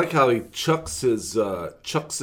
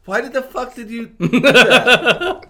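laughing disbelief; 10 semitones higher, 6 dB louder, slower than usual. Real, not acted.

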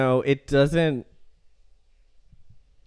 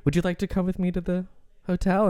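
deadpan French seducer; 5 semitones higher, 4 dB quieter than usual. Retold or imitated. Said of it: "very deadpan".

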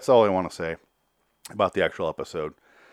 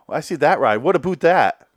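exaggerated Canadian accent, pitched up and loud; mock Canadian accent; 6 semitones higher, 6 dB louder than usual. Host mocking the show's Canadian cast, exaggerated Canadian accent. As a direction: lay on a cartoonish Canadian accent. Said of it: "Everyone in this fucking show is Canadian".

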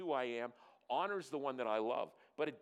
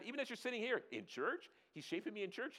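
incredulous question; 7 semitones higher, faster than usual. Acted. Voices a doubting listener's question.

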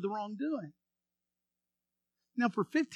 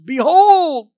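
put-on loud, higher, ringing old-fashioned cry; grand proclamation; 6 semitones higher, 15 dB louder than usual. Preacher reading the King James cry, loud and grand. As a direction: proclaim it like scripture.

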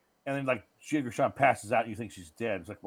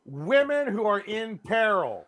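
grand announcement; 8 semitones higher, 5 dB louder, slower than usual. Real, not acted.

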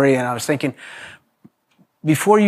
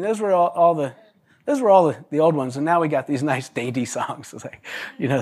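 usual speaking voice

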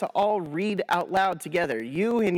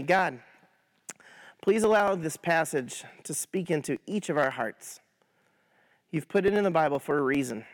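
prayer; 4 semitones lower than usual. Acted. Leads a prayer.

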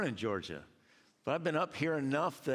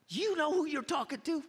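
much higher, mock-offended retort; indignant dad; 14 semitones higher than usual. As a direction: act outraged at being corrected. Dad retorting to his son.

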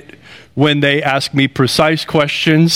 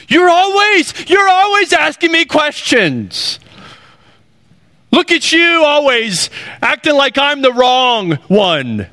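hammed-up petulant protest; angry person's complaint; 10 semitones higher than usual. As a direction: play the resentful accuser turning the blame. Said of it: "That's what you do, isn't it? That's what anger does".

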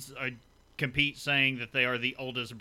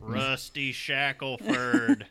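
slow, lightly voiced cartoon drawl; a hint of Dale Gribble; Dale Gribble impression; 3 dB louder, much slower than usual. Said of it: "Dale Gribble?".

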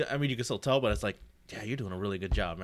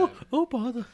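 very high, amateur bear impression; higher-voiced cartoon bear attempt; Winnie the Pooh; 4 dB louder than usual. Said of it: "dude who's doing a higher voice".

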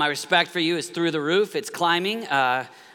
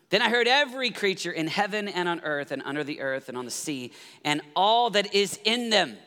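normal talking voice

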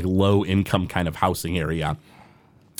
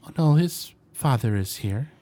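a lightly voiced meek half-elf woman, a little higher; meek mother; 5 semitones higher than usual. The shy mother answering strangers at her door, meek and hesitant. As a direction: answer timidly, barely pushing the words out. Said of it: "a very meek-looking half-elf woman".

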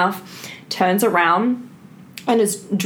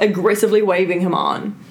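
usual speaking voice

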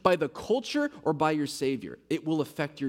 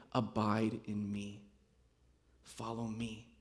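slow, hushed call to follow; soft invitation; 5 semitones lower, 9 dB quieter, slower than usual. Acted. Preacher voicing Jesus's call to his followers, soft and slow. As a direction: lower your voice into a quiet, unhurried invitation.

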